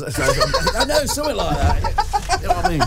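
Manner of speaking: words pushed out high through real laughter; laughing. Real, not acted. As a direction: crack up while still talking. Laughing at his own kid act.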